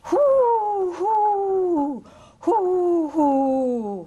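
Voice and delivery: high loud wind howl falling away. Acted. Snowstorm wind sound.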